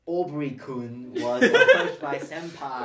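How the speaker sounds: laughing mock anime narration; slow, giggly anime parody line; excited anime fan. Mock anime visual novel line.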